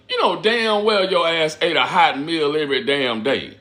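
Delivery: ordinary talk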